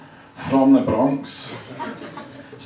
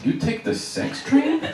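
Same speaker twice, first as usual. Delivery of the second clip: high, softer questioning colleague; imitating a taken-aback colleague; incredulous echo; 11 semitones higher, 4 dB quieter than usual. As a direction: repeat it back as a puzzled high question. Colleague echoes him in disbelief.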